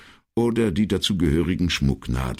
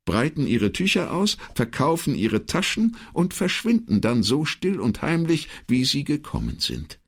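high-pitched secretary clarifying; explaining secretary; 9 semitones higher than usual. Acted. Secretary explains to the puzzled commissario, high-pitched.

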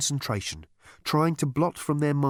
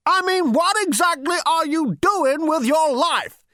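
high, loud indignant demand; berating old man; 6 dB louder than usual. Acted. Great-grandfather questions Zaphod's life, indignant and high. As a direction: berate him in an elderly screech.

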